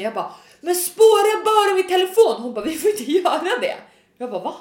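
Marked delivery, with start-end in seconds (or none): 0.6-2.4 exasperated plea. Retold or imitated. Exasperated plea to emergency operator.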